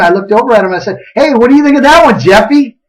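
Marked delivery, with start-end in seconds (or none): 1.1-2.8 higher, quick, breezy question; chirpy address. Retold or imitated. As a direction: call over cheerfully, unaware of trouble. Re-enacts the final chirpy question.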